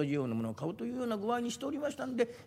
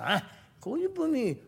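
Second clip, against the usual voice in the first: slow, much higher drawn-out surprise; surprised collector; 10 semitones higher, 4 dB louder, much slower than usual. Acted. Collector hearing of a love letter, surprised and slow. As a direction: savor the word in surprise.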